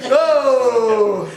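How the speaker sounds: loud laughing oh; laughing exclamation. Real, not acted. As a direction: burst out laughing. Host laughs at answer.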